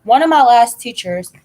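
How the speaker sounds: ordinary delivery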